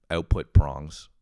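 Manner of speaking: usual speaking voice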